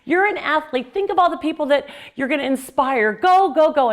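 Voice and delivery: loud, high-pitched cheering pep talk to yourself. Shown as a demonstration. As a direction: cheer yourself on like a coach at the finish line. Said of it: "I trained my mind to talk to me".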